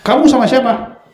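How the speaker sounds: high, loud suspicious demand; accusing intonation. Shown as a demonstration. Husband showing the intonation that starts quarrels, accusing. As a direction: fire the question like an interrogation. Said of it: "intonasi berbeda".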